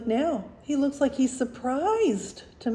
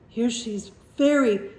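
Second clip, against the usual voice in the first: ordinary delivery, nothing marked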